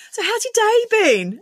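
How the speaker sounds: very high sing-song small talk; put-on cheery, high-pitched greeting; chirpy receptionist. Receptionist making small talk with a customer, very high and chirpy.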